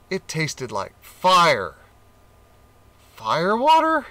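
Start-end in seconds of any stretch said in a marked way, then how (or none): 3.2-4.1 high, loud startled question; surprised. A narrator's reading. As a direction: gorilla king tastes fire, surprised question. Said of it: "he said".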